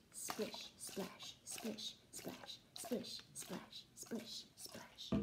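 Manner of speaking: breathy whispered rhythm; whispered swish swash. Acted. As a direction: whisper the grass noises softly. Whispered water sound words.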